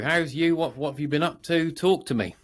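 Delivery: ordinary delivery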